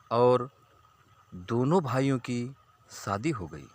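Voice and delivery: ordinary delivery, nothing marked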